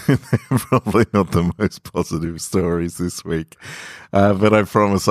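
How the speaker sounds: wry laugh through the words; sheepish laughing apology. Real, not acted. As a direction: chuckle at your own gloomy choice. Laughs at own downbeat stories.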